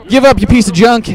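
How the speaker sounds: lightly voiced boss taunt, high and loud; mock taunt. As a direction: throw a loud, high-pitched game taunt. Voices boss's taunt in game.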